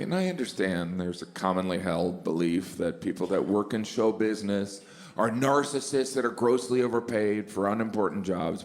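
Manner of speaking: normal talking voice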